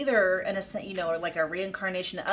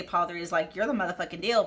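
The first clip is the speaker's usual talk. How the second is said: ordinary delivery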